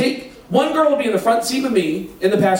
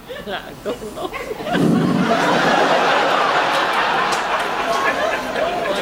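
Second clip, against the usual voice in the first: real laughter. Real, not acted. Laughing at the memory.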